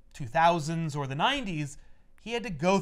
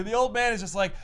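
ordinary talk, nothing marked

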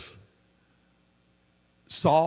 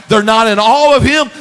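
"awe" cried out loud and high; loud preaching cry; 7 semitones higher, 10 dB louder than usual. Real, not acted. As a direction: shout "they're not in awe of him" at the peak. Loud line then hushed repeat.

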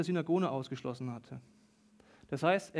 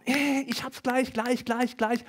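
higher-pitched, drawn-out groping for the answer; straining guesser; 6 semitones higher, slower than usual. Acted. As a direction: act out someone puzzling at charades. Voices people guessing at charades.

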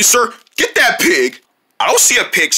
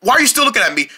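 ordinary talk

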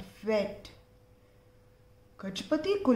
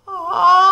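loud, high elephant sneeze; big sneeze; 8 semitones higher, 10 dB louder than usual. Acted. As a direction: burst out a huge sneeze. Sneeze again.

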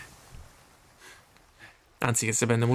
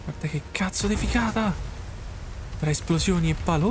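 excited; 6 semitones higher than usual. Real, not acted. Excited reaction to explosions.

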